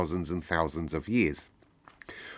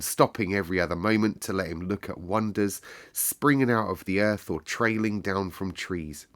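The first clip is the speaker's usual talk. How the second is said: ordinary delivery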